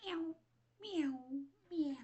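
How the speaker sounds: mock cat meow. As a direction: mimic the kittens begging. Imitating the cats meowing.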